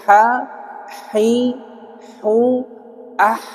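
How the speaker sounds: "Ha, hi, hu, ah" one by one with a clear h; h-sound practice. Shown as a demonstration. Teacher modelling what must be practised, articulation drill. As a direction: sound each h in "Ha, hi, hu, ah" separately. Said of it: "harus dibiasakan dulu".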